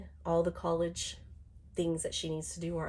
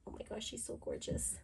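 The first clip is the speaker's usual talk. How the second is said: whispered; 3 semitones higher, 11 dB quieter than usual. Real, not acted. Admiring the doll, breathless.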